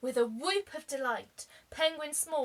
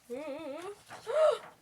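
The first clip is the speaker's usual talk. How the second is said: surprised gasp; 4 semitones higher than usual. Real, not acted. Reader discovers the whale.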